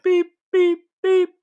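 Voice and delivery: high, loud mock beeping; electronic beeps. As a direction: beep like a machine. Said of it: "It's like".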